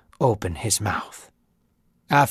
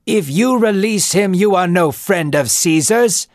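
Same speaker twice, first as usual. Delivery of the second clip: warning shout; 6 semitones higher, 4 dB louder than usual. Acted. Crowd yells from outside.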